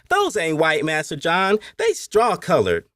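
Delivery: put-on high-pitched child correcting his playmate; high, matter-of-fact boy; young boy Kumi. A narrator's reading. Enslaved boy answers young master.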